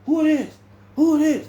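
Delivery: high, loud urgent calls; officers shouting. Retold or imitated. Officers storming the holding cell, shouting high and loud. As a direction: bark out like guards rushing in. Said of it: "They ran down there".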